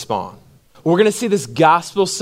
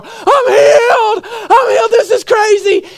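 much higher, loud joyful shout; ecstatic scream; 4 dB louder than usual. Retold or imitated. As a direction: yell it like a man just healed in a crowded bar. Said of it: "He's screaming in the middle of the bar".

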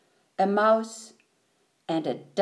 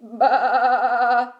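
long quavering baa; sheep bleat; 4 dB louder than usual. A narrator's reading. Sheep answers Duck's hello, bleating. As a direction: bleat like a sheep with a fast wobble. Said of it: "said Sheep".

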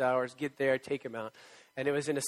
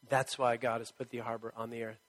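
ordinary talk, nothing marked

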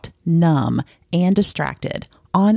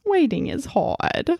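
put-on higher, softer little kid voice; sweet cartoon child line; cute little kid voice; 7 semitones higher, 3 dB quieter than usual. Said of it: "in his cute little kid voice".

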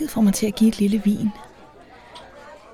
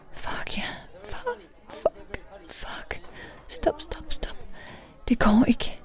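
breath-only whisper, rising higher in places; very soft; 9 semitones higher, 11 dB quieter, slower than usual. A narrator's reading. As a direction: whisper it in broken fragments. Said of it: "mens jeg sagte uddyber". (sagte, hviskende og afbrudt)

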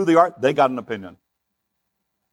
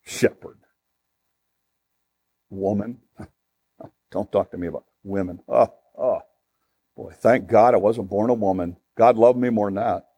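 low, disgusted muttering with scoffs; Pharisee's scornful attitude; 6 semitones lower than usual. Acted. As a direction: scoff as a Pharisee who finds them beneath him. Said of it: "That was their attitude".

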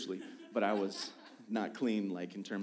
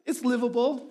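high, loud, laughing plea; pleading younger self; 12 semitones higher, 7 dB louder than usual. Retold or imitated. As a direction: whine the excuse with a laugh. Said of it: "I was like".

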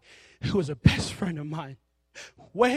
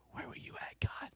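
whispered plea; 15 dB quieter than usual. Acted.

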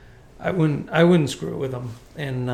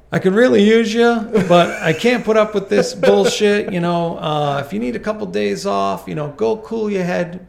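raised, higher voice of a boss; acted firm talking-to; 5 semitones higher, 7 dB louder than usual. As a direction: lay down the law loudly to a worker. Boss laying down the law, acted.

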